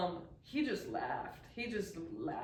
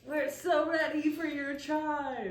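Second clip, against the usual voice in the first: loud, higher, stretched-out playful call; mock eager customer; 7 semitones higher, 7 dB louder than usual. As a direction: call out like an overkeen camper at the café door. Imagined campers shouting at café.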